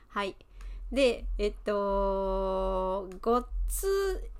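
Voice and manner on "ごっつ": ごっつ sprung up high with a bounce on the small っ; ごっつ pitched high, clipped on the っ; bouncy Osaka gottsu. Shown as a demonstration. Teacher showing students the Osaka ごっつ, bouncy. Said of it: "弾むような言い方".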